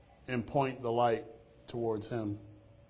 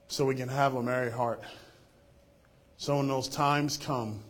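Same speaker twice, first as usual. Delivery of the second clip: loud, ringing pastoral prayer; fervent prayer; 2 semitones higher, 7 dB louder than usual. Real, not acted. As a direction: pray over the congregation with fervor. Pastor leads closing prayer.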